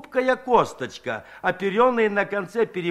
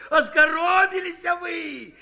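much higher, slow, louder yell through laughter; laughing shout; 12 semitones higher, 4 dB louder, slower than usual. Acted. Kondrat teases the women through laughter, high laughing shout. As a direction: squeeze the words out between laughs. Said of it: "на силу выкрикнул".

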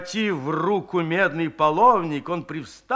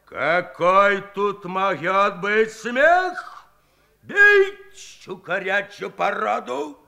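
fierce yell; 2 semitones higher, much slower than usual. A narrator's reading. Akim roars at the laughing men, furious. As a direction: roar for blood. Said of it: "свирепо заорал".

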